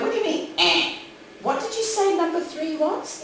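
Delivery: high, puzzled query; baffled question. Retold or imitated. Acts out her baffled reaction.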